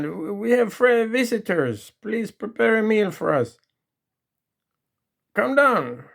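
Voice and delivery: husband ordering his wife down; calling upstairs. Retold or imitated. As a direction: summon her down. Man calls up to wife.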